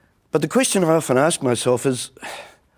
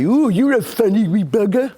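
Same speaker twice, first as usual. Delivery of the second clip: mimicking adults; 9 semitones higher, 4 dB louder than usual. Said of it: "people used to say".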